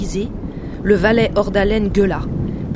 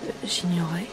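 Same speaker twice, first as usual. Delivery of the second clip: softer, hesitant murmur; soft stammer; 8 dB quieter than usual. A narrator's reading. The count answers Marie, softly stammering. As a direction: falter gently, well under the narrating voice. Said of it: "Le comte doucement balbutia".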